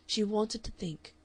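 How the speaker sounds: ordinary delivery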